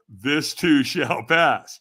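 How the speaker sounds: slow, weighty repeat; slow quote. Retold or imitated. Tom Hanks repeating his advice, slow and weighty. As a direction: draw it out as a lesson. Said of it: "He goes".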